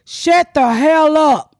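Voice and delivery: hammed-up slow comeback; mock shout back. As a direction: fire it back slow and sassy. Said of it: "I said".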